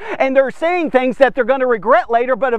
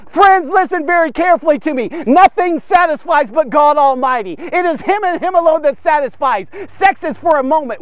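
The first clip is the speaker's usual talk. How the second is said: raised sermon voice pleading with men; full preaching; 5 semitones higher than usual. Acted. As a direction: preach straight at them. Preaching climax.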